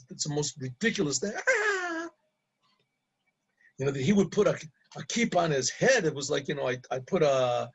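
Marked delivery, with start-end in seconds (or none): 1.4-2.1 high mimicked cackle; imitated bursting laughter. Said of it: "this lady, she is just bursting with".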